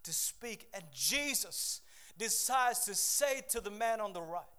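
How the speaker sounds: loud, high, driving sermon voice; full-force preaching. Acted. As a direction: preach it with everything you have.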